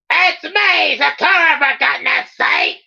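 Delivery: very high, hammed-up Australian yell; shouted. Sakura shouts at the screaming boys, exasperated Australian yell. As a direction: squawk it out way up high in Aussie. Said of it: "Then she shouted".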